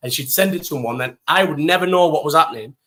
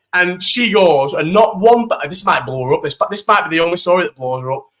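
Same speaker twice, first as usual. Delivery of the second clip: ordinary talk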